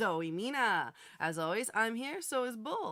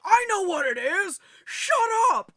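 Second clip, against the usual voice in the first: loud, higher retorting yell; mock angry yell; 10 semitones higher, 12 dB louder than usual. Host replaying her yell at Bull over the tripod. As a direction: snap back loudly in a replayed fight. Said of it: "I'm like".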